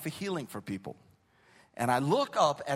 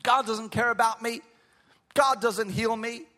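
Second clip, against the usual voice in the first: retold yelling; 5 semitones higher than usual. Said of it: "She started yelling at me".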